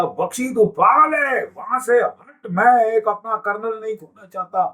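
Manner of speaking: exasperated senior officer berating a colonel over radio; imitated commander's scolding. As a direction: act out an alarmed commander's outburst.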